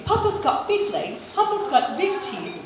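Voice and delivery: put-on little girl's high, crisp British voice; small girl reciting in crisp British English; crisp British accent. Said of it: "in a crisp British accent".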